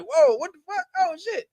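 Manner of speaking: high, startled mock gasp of fans; mock shock. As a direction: act out their stunned reaction. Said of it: "They were like".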